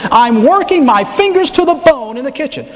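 high, complaining whine; hammed-up put-upon sister; whiny Martha. Martha whining high to Jesus about her work. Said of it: "I don't know why I'm doing this".

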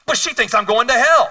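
put-on high, loud offended little girl; upset child's wail.

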